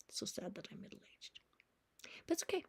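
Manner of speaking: a soft, voiceless whisper to yourself; whispered aside. Real, not acted. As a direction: murmur it as a private joke. Self-pitying joke about age.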